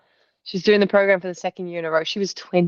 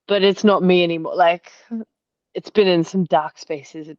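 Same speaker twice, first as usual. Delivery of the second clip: ordinary talk, nothing marked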